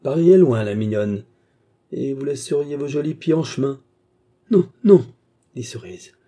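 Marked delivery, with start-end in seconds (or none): 0.0-3.8 loud, high-pitched taunt; mock her plan with relish; sneering. A narrator's reading. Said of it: "ricana la vieille". 4.5-5.0 high, loud, urgent protest; desperate refusal. A narrator's reading. Jeune fille refuse, désespérée.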